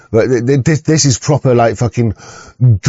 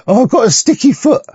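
high, silly mocking voice; mock a show-off in a squeaky high pitch; high sneering impression of a bragger; mocking boast; 12 semitones higher than usual. Mocking certificate boast again.

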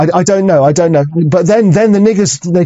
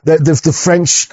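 ordinary delivery

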